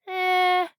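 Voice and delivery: high, drawn-out bored "eh"; unimpressed, level-pitched brush-off. A narrator's reading. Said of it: "sounding bored".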